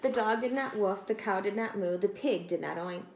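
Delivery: plain reading voice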